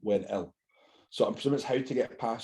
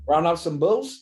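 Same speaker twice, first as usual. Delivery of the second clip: put-on Australian accent; 7 semitones higher, 4 dB louder, slower than usual. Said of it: "Gotta do an accent".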